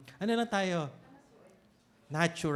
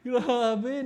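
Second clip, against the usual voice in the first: normal talking voice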